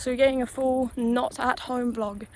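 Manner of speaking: usual speaking voice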